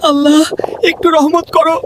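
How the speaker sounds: high, mildly anguished complaint; hungry wail. Acted. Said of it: "ক্ষুধার যন্ত্রণায় আর্তনাদ করে সাজু বলল".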